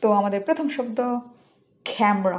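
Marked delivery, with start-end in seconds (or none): 1.9-2.4 "ক্যামেরা" as KHAM-ra, puff on the k; aspirated k. Shown as a demonstration. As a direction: open "ক্যামেরা" with a breathy k, two syllables. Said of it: "আমি কিভাবে উচ্চারণ করি".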